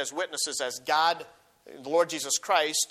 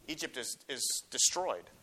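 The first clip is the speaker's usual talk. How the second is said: ordinary delivery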